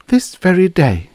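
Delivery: high, loud emphatic charge; accusing. A narrator's reading. She counters with her own accusation.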